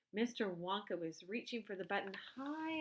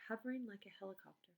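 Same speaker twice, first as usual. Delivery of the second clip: usual speaking voice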